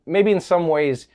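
usual speaking voice

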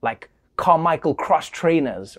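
normal talking voice

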